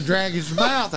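ordinary delivery, nothing marked

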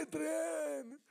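hammed-up movie-hero shout for his love; Rocky impression. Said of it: "that's your go-to Rocky".

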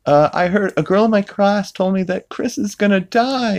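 mock tearful, high-pitched voice; Meg. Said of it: "crying to Peter".

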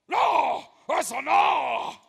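high, strained demon shout in a foreign-sounding voice. Retold or imitated. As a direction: shout it as the demon refusing, slowing on the end. Demon refuses the prayer.

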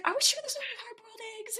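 high, unvoiced whisper; mock whisper. Phaedra whispering at breakfast, asking about eggs, hushed and high. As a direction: hush it like a secret snack question. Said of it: "She's like".

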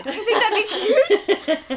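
high, loud, slow mock-sweet question; hopeful wife. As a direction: imitate a wife eagerly pitching her idea. Wife pitches baby name hopefully.

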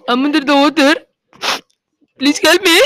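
put-on Indian accent. Calling for help from under the water, Indian accent. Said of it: "with your Indian accent".

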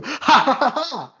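high hammed-up evil laugh; villain laugh. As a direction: cackle like a cartoon villain. Mock evil laugh after ranking.

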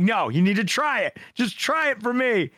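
high-pitched mock pleading; parental pleading. Host begging his picky friend to taste it, pleading. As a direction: plead upward like an exasperated parent. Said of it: "treat him like my daughter sometimes".

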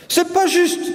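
high-pitched schoolboy complaint; protesting child. A narrator's reading. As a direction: object like an indignant pupil. Boy protests to the teacher.

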